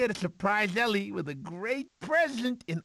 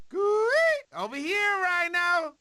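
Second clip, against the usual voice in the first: calling out; 10 semitones higher than usual. Acted. Taunting rhino.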